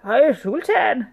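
high, slow, loud greeting; servile rat. A narrator's reading. Rat bowing before the sultan, servile greeting.

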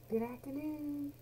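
bright high-pitched afternoon greeting; high sing-song greeting. Shown as a demonstration. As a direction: lilt it up high and gentle. Greeting variations demo.

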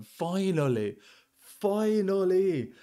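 high, drawn-out cry of relief; overjoyed. Real, not acted. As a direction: stretch it out, finally got it. Rare drop joy.

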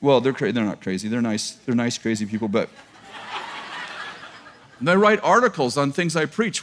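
usual speaking voice

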